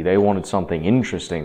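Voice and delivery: ordinary delivery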